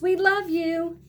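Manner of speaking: high, loud happy call; a child calling out with love; Yolanda yelling goodbye to her teacher. A narrator's reading. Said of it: "Yolanda yells".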